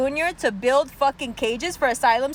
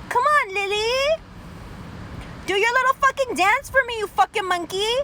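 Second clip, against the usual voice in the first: high, sing-song patronizing voice, much higher; condescending fake-sweet voice calling a pet; mock pandering politician; 5 semitones higher than usual. Mocking Kamala courting brown voters.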